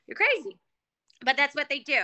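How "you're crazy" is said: higher and louder, incredulous; exclaimed disbelief. Retold or imitated. Reacting to women sending money, high exclaimed disbelief. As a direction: burst out in disbelief. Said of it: "I'm like".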